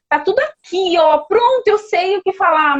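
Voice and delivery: mock-confident before the hearing; confident lawyer. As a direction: lawyer bragging to herself before the blank. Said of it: "você foi pra audiência".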